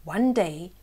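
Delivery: ordinary delivery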